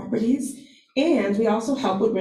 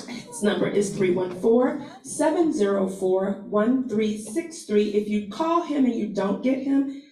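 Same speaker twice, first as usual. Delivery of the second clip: usual speaking voice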